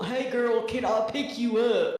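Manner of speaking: usual speaking voice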